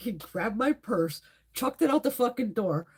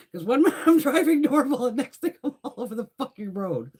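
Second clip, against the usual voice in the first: laughing through the words, louder; can't stop laughing while telling it; 5 semitones higher, 6 dB louder than usual. Real, not acted. Laughing while retelling chaos.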